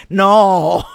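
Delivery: long, loud, drawn-out "no"; aghast shout. Acted. Psychoanalyst jolted awake by his patient's confession, aghast shout. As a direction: howl in horror at what's coming.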